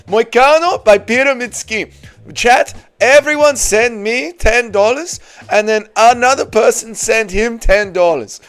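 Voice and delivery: a high put-on voice reading the donation; lightly voiced mock chat reader. Streamer reading a donor's message in a mock voice.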